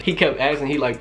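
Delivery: ordinary talk, nothing marked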